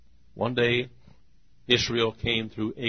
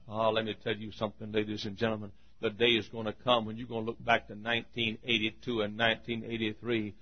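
ordinary delivery

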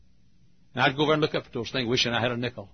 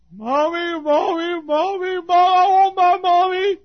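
high, whining cries for mommy; child crying; 9 dB louder, slower than usual. Retold or imitated. Lost child crying for his mommy, high and loud. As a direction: whimper it like a lost boy. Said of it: "Oh, I'd cry".

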